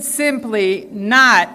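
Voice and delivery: "not" drawn out slow and weighty; emphasis on not. Real, not acted. Senate president stressing "not" to the audience, slowed and weighty. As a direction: lean hard on "not", stretching it. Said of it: "and I emphasize the word not".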